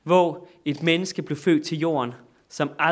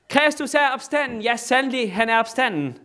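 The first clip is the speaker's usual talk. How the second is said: high, loud Easter cry and answer; preacher acting out the Orthodox Easter call, louder; call and response; 7 semitones higher, 4 dB louder than usual. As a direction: proclaim it and answer it yourself. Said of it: "Tre gange råber de det".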